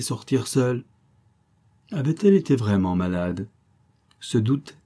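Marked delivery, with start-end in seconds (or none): none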